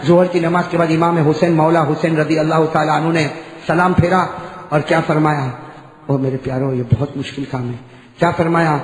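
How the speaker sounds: ordinary delivery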